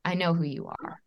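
doctor gravely admitting he knows the caller; heavy, weighted voice. Retold or imitated. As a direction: let each word carry weight, low. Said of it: "the weight in his voice was like".

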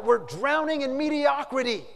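high, loud acted climax; yelled. Editor's final cry to his workers, yelled. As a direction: yell it out like a last warning. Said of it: "then he yelled out".

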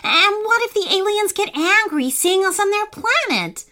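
put-on high, worried little boy; a hint of a frightened child; scared child voice.

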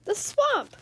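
high cry of fright; scared cry. Acted. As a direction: team recognizes the dreaded coach, fearful cry. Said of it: "cried the team".

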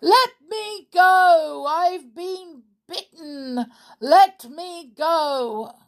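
high, slow, drawn-out wail; anguished. A narrator's reading. Trapped man calling from inside the curtains, anguished. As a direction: cry out loudly to be freed. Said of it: "An anguished voice came".

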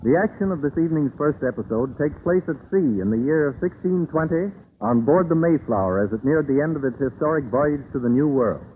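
ordinary delivery

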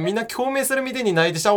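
ordinary delivery

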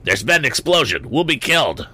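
high frantic cry; panicked. A narrator's reading. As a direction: panic about an explosion. Andy panics after dirt.

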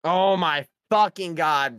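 slow, loud, drawn-out disbelief; real shock at the answer; stunned outburst. Real, not acted. A player learning the answer was a water fountain, in loud disbelief.

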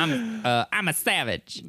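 high rap imitation; a hint of Megan rapping; [name]. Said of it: "That, that song".

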